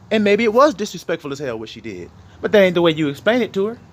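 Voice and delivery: normal talking voice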